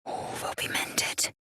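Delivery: hushed reassurance; quiet whispered promise; soft whisper. Acted. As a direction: whisper it gently.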